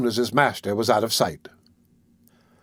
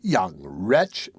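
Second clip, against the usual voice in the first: low scornful woman; 6 semitones lower than usual. A narrator's reading. A bystander condemns Oliver.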